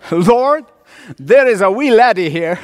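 put-on playful Scottish accent in prayer. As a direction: address the Lord as a comic Scot. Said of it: "with a Scottish accent".

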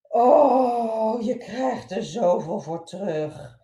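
loud, slow, hammed-up sob; mother wailing over the mess on the rug; wailing despair. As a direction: cry it out to the ceiling. Mother breaks down after accident.

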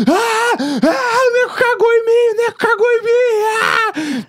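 very high, drawn-out mock wail; hammed-up screaming and crying in horror; imitated wailing. Friend wails as the teller leaves the shower, high horrified screaming.

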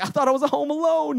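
high, loud comic alarm; mock panic. Man believed nobody saw his sin, mock panic. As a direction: protest loudly as if caught out.

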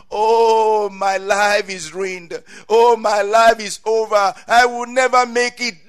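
loud, high hammed-up wail; despairing lament. Someone destroying their life with words, despairing lament. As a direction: cry it out in mock despair.

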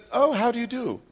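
high, genteel British greeting; British accent. Acted. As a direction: imitate an English lady's accent, pitched high. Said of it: "in her beautiful British accent".

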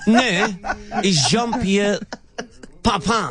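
put-on French accent. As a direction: insist in a hammed-up Frenchman voice. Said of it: "Pepe Le Pew".

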